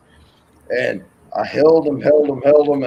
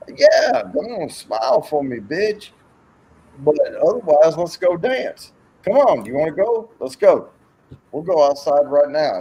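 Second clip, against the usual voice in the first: higher, pushy mock taunting; retold goading challenge; 5 semitones higher, 4 dB louder than usual. Bluffer daring a bar bully to fight, goading. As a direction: goad him into stepping outside.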